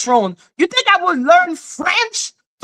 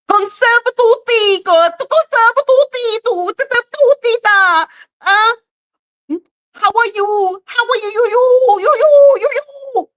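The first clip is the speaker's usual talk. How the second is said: high, singsong nonsense parody; mocking how they sound; 9 semitones higher than usual. Showing how they would sound in English, high mocking babble. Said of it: "that's how they sound to me".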